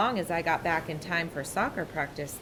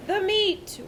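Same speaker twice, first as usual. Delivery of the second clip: mild crew roar; 14 semitones higher than usual. A narrator's reading. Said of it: "we all roared".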